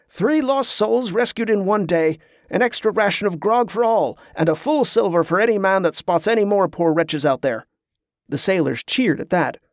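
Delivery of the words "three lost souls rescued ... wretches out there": higher-pitched announcement to a crew; calling out. A narrator's reading. Captain rewards the sailors for the rescue, calling out. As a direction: proclaim it to the assembled sailors. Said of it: "he called out".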